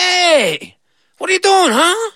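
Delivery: high cheeky goat voice; brash goat challenging the girl. Acted. Goat confronting the little girl, cheeky high voice.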